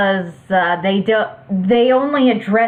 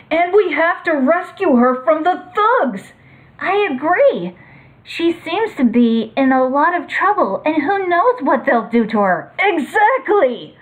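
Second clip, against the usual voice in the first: high eager hero voice; 7 semitones higher than usual. Acted. Heroes react, eager to rescue.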